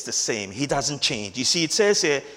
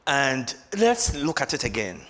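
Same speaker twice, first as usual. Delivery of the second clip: ordinary delivery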